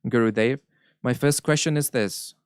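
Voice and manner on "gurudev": normal talking voice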